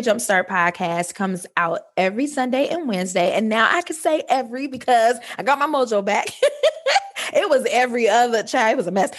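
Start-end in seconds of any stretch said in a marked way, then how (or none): 6.3-7.3 bright laugh soaring up; real high laugh. Real, not acted. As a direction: laugh at her own mess. Laughs about getting mojo back.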